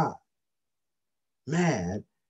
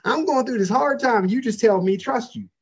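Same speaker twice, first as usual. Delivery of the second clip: higher, mock whiny protest; complaining young self; 6 semitones higher, 3 dB louder than usual. Preacher mocking his younger self's complaint, higher and whiny. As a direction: whine it back like a frustrated new believer. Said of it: "I used to go".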